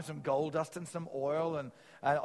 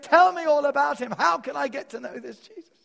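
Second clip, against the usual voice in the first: loud, high, pleading cry; desperate plea; 11 semitones higher, 12 dB louder than usual. Retold or imitated. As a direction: beg him to tell you, at full voice. Soldier's plea continued.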